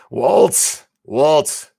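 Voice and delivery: loud, high call. Real, not acted. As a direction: call his name across the room. Calling out to Walt.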